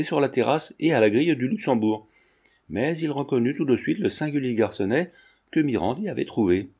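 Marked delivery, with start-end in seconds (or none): none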